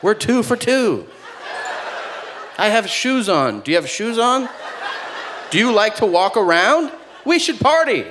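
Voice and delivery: high, giddy mock enthusiasm; hammed-up over-eager bonding; mock excitement. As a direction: build fake thrill up to a high peak. Feigned excitement over a trivial connection.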